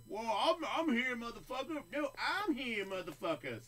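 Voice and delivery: high, loud boastful shout; hype-man boast. Retold or imitated. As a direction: crow it like a cocky fighter. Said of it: "the hype train. Like".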